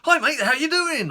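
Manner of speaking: very high, loud, gushing hello; match the over-the-top cheer; worked into a frenzy. Retold or imitated. Courier at the door, in a gushing frenzy. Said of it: "worked himself into a frenzy".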